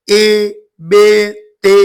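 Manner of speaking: "ए बे ते" with an open e, not ee; teacher showing the wrong "ए बे ते" sound; majhool e vowel. Shown as a demonstration. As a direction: say "ए बे ते" with a flat e vowel. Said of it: "ये मजहूल आवाज है".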